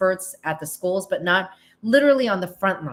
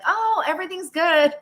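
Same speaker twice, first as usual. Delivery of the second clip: bright, much higher put-on breeziness; fake cheerful; 11 semitones higher than usual. Parent hiding stress from the kid with a mock cheery voice. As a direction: brush it off like nothing's wrong. Parent pretending all is fine.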